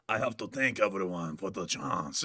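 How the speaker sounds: a hint of Zelensky rasp; lightly voiced Zelensky. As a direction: rasp it like a tired leader. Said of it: "And now he's like".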